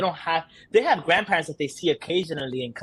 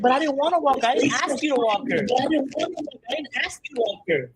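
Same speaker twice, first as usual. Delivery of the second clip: put-on much higher tearful kid protest; imitated crying son; 10 semitones higher than usual. Son telling mom he never asked for the leash, mock tearful whine. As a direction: plead like a wronged, teary little boy. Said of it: "so he starts crying".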